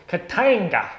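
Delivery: loud, high, showy proclamation of the name; game-name battle cry. Shown as a demonstration. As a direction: belt out KATANGA with a big swoop on TANG. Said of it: "say it like that as well".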